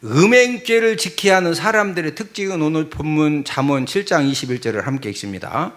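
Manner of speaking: ordinary talk, nothing marked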